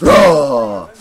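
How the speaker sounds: a sharp loud shouted Ah; sudden loud cry. Acted. Mystic bursts out with a loud shouted Ah.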